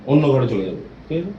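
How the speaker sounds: ordinary talk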